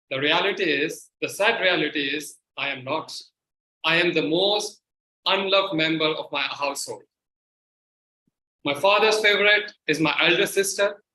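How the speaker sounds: ordinary talk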